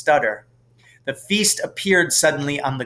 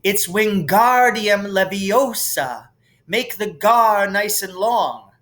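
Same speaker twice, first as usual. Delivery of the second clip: slow, high, long drawn gar in Wingardium; know-it-all correction; 7 semitones higher, 4 dB louder, slower than usual. A narrator's reading. Hermione correcting Ron's pronunciation, long gar. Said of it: "Hermione snap".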